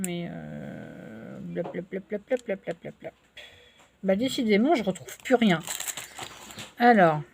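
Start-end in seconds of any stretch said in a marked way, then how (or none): 0.0-3.1 quiet mumbling. Real, not acted. Mumbling filler while searching.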